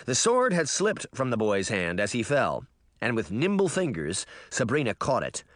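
usual speaking voice

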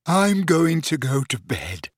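sleepy yawning voice. A narrator's reading. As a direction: yawn through the words. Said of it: "yawned Ginny".

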